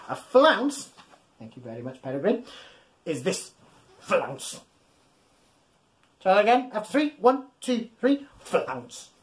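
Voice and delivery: plain reading voice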